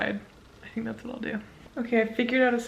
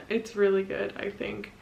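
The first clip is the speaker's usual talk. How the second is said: ordinary delivery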